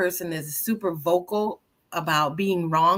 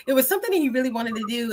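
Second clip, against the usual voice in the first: normal talking voice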